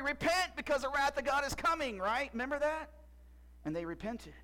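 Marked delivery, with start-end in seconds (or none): 0.0-2.0 high, loud call to repent; mock prophet shout. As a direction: cry it out like a street prophet.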